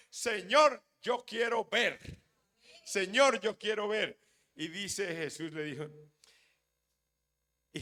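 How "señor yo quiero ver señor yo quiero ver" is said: súplica ferviente, alta y fuerte; clamor ungido. Acted. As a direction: clama al Señor con fervor. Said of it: "El ciego Bartimeo estaba ungido y dijo". (clamando con fervor, en voz alta)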